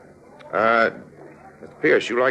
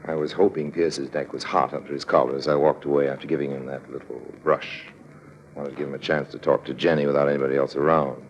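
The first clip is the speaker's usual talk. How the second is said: cool, knowing narrator voice; lightly voiced sly narrator, telling it to the audience; smug storyteller aside; story narration; 6 semitones lower, 7 dB quieter than usual. A narrator's reading. Harry Lime narrates the scheme.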